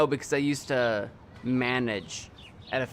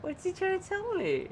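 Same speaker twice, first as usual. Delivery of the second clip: high cooing voice to a pet; 12 semitones higher than usual. Real, not acted. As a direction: coo sweetly at the little shark. Cooing at a game shark.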